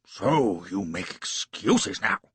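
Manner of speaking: slow, sneering affected snap; snapping lord. A narrator's reading. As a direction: cut him down with scorn. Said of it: "snapped Cardigan".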